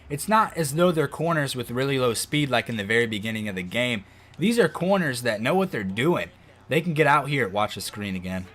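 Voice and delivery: normal talking voice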